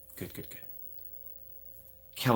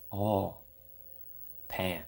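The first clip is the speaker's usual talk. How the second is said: ordinary talk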